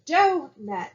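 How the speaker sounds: "Donut" high and loud on the first syllable, a little gap after it; stress and gap. Shown as a demonstration. Teacher showing the cadence of a word, "Donut" split and front-stressed. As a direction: punch the start of "Donut", then break before the end. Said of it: "it is a little gap in there".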